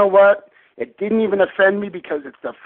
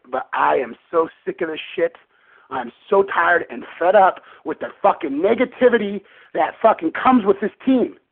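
ordinary delivery

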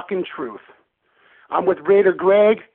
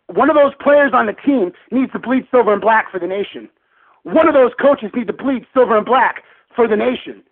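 louder, higher, impassioned fan rant; real angry, raised-voice venting; fired-up rant; 4 semitones higher, 3 dB louder than usual. Real, not acted. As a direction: rant hard, driving each line home. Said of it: "after that rant".

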